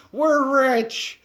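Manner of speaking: high, loud gleeful whoop; excited cheer. Pretend. Trapper imagining a big auction windfall, cheering excitedly. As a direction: shout it like you just struck it rich.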